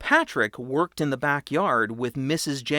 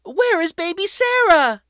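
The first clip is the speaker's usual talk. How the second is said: very high, loud panicked cry; alarmed cry; 7 dB louder than usual. A narrator's reading. Mother realising the baby is gone, high and panicked. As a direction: gasp in sudden fear. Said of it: "Mother cried".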